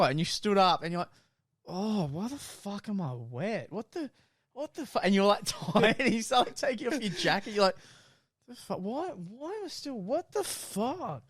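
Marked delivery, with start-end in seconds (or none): 1.7-5.0 higher pitched, slow, baffled imitation of a just-woken friend; groggy confused waking up. 8.5-11.2 higher pitched, slow, baffled imitation of a just-woken friend; groggy confused waking up.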